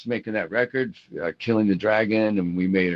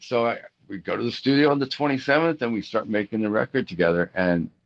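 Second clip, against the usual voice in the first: ordinary talk, nothing marked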